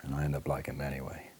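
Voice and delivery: ordinary talk, nothing marked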